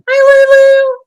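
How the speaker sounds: high, loud call-out hello; excited greeting. Real, not acted. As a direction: greet a new arrival way up high. Calls out greeting to arriving viewer.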